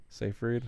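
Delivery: Seyfried said SAY-freed, rising like a question; tentative, soft "SAY-freed?" for Seyfried; questioning pronunciation. Real, not acted. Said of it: "I don't know how to say it".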